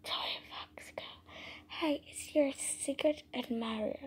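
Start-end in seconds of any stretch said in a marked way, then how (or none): none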